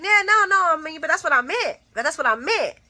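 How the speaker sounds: high, fast, flustered backpedaling; flustered liar backpedaling; frantic backpedal. Retold or imitated. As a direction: scramble to cover the lie.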